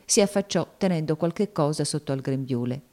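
ordinary talk

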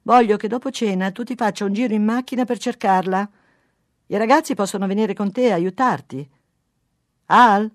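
loud, insistent instructions; calling out. A narrator's reading. Wife in the kitchen to husband in bathroom, calling out. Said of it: "gridò lei dalla cucina".

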